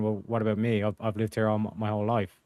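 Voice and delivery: ordinary delivery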